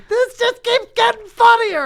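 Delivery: very high, loud cartoonish squeal; high-pitched squeal. Comic retelling the pat-down, high cartoonish squeal. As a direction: squeal it in mock delight. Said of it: "And I was like".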